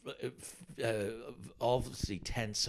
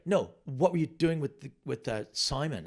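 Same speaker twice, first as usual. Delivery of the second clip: mock angry client; 5 semitones higher, 6 dB louder than usual. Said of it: "And she was like".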